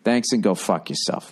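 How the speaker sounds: normal talking voice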